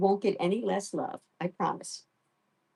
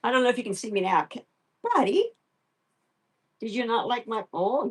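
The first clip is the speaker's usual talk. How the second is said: normal talking voice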